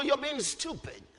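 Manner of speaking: usual speaking voice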